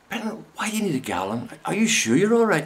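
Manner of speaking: hint of a fretting woman; worried chemist. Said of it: "She went".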